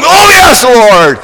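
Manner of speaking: high, loud jolted cry with a laugh; startled yelp. Pretend. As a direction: jump as if shocked. Startled by shock collar.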